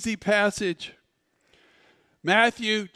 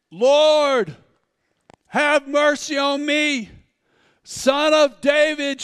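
pleading call, higher and louder, slow on Son of David; crying out; 5 semitones higher, 4 dB louder, slower than usual. Acted. Canaanite woman calling to Jesus for help, desperate cry. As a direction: beg across the crowd for mercy. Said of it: "she began to cry out".

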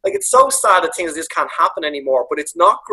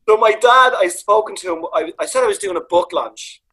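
ordinary talk

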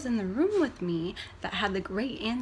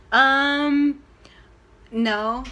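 louder awkward "Um, no"; blurted answer; 3 semitones higher, 9 dB louder than usual. Real, not acted.

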